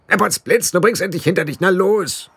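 high, cheeky little blue man; a squeaky Nac Mac Feegle egging Roland on; high pixie voice. A narrator's reading. Pixie urging the boy to get it over with, high squeaky voice.

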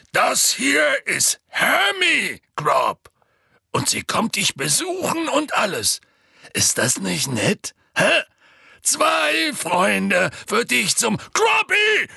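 slow, high, loud presentation; Hagrid. A narrator's reading. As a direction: coax a giant at shouting volume. Hagrid presents Hermi to the giant. (laut und gedehnt anpreisend)

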